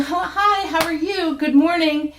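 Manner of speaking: bright greeting. Retold or imitated. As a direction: greet the supermarket staff brightly and eagerly. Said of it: "Instead of saying".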